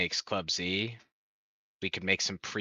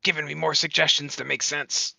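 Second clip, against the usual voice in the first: higher-pitched, playful imitation of someone yelling; mock yelling; 8 semitones higher, 6 dB louder than usual. Gamer mimicking a teammate telling him to stop suggesting, mock yell. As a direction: imitate a yelled complaint, pitched up, only moderately loud. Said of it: "He's over there yelling at me like".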